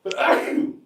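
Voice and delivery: sudden loud sneeze, real; a real sneeze bursting out. Real, not acted. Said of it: "I'm going to sneeze".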